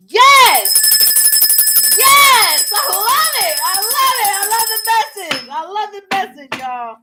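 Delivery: high, loud delighted yes; squealing delight at the card; thrilled. Real, not acted. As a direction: burst out with real joy.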